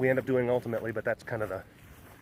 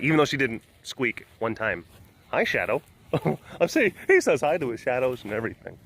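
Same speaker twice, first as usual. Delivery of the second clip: normal talking voice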